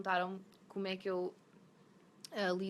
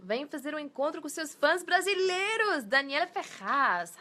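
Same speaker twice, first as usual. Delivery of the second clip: put-on terrible Brazilian accent; 8 semitones higher, 10 dB louder than usual. Reading a Brazilian fan's question, hammed-up Brazilian accent. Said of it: "terrible Brazilian accent".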